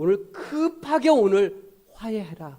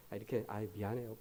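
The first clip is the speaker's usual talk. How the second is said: sheepish apology; 8 semitones lower, 15 dB quieter, faster than usual. Retold or imitated. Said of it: "시간 지나면".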